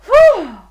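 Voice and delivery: loud, high whew; big relieved whew. A narrator's reading. Storyteller sighing with relief for the children. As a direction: blow out relief.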